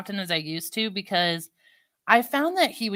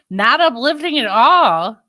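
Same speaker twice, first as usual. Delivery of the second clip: much louder and slow, drawn out in dismay; 5 semitones higher, 12 dB louder than usual. Real, not acted. Reacting to the storm report, loud slow dismay. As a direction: lean on every word, loudly and slowly.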